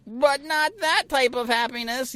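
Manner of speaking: high-pitched mock protest; mock objection. Co-host mocking objectors to others' happiness, high mock protest.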